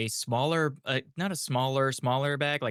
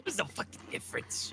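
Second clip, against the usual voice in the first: high hushed whisper, amid laughter; whispered exasperation; 7 semitones higher, 8 dB quieter than usual. Real, not acted.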